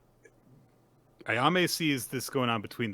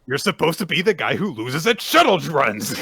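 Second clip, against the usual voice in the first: high, loud playful rant; mock outrage; 7 semitones higher, 10 dB louder than usual. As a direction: shout in exaggerated protest at a character. Mock scolding of a fictional character.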